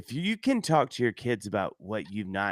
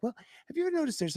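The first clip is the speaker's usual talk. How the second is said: pitched-up quick mock question; high, fast curious outsider; 3 dB quieter, much faster than usual. Future spouse quizzing the family, high and fast. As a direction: play the puzzled newcomer, higher and quicker.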